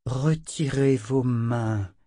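very slow, menacing warning; slow menace. Acted. Callan warns Michael off her body, slow and menacing. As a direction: spell out each word as a threat. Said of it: "dit lentement Callan menaçante".

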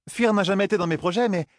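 high-pitched huntress, arguing; protesting Zarine. A narrator's reading. Huntress objects to what her oath now demands, high and protesting.

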